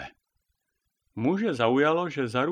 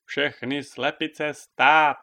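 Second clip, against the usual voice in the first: slow, loud command to halt; called-out order; 3 semitones higher, 7 dB louder, much slower than usual. A narrator's reading. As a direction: call the whole flock to a stop. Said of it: "zvolala Sabina". (zvolání, hlasitý rozkaz)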